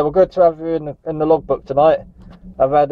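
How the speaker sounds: normal talking voice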